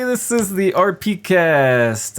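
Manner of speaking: loud high show intro; announcer voice. Acted. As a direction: boom out the show opener.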